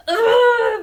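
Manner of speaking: loud, high-pitched "ugh" of frustration; exasperated groan. Real, not acted. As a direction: let out a big, high groan of anger. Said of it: "it makes me so mad".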